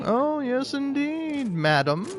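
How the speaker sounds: high, sing-song fake British accent; faux British accent. Hitting a friend with sarcasm, faux British accent. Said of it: "doing my faux British accent".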